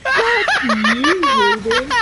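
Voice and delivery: high and loud, laughing it out; playful laughing insult; laughing fuck you. Retold or imitated. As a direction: laugh as you curse him. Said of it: "I was like".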